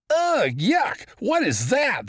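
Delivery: high, loud yuck of disgust; boy's disgusted scream. A narrator's reading. Boy recoiling from a fish for breakfast, disgusted high yell. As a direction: shriek it like a grossed-out kid. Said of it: "the boy screamed".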